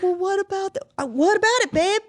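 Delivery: high, whiny clueless husband voice; mocking clueless husband. Woman mocking a clueless husband at the holidays, high and whiny. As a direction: play the helpless man asking where it comes from. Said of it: "the men would be like".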